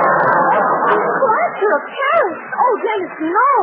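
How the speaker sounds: high, slow, drawn-out dismay; aghast realization. Acted. Mildred to Dennis, realizing the disaster, drawn-out dismay. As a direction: stretch it out in horror. Realizing the powder was plaster.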